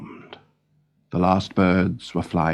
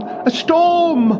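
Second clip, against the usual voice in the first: Noggin crying out. Acted. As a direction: shout to the crew over the storm. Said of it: "cried Noggin".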